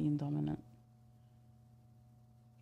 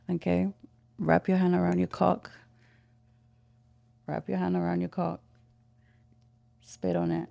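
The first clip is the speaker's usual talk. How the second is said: teasing, commanding, a bit louder; sultry phone sex voice; 2 semitones higher, 5 dB louder than usual. Shown as a demonstration. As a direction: play the dominant caller. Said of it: "get in full character".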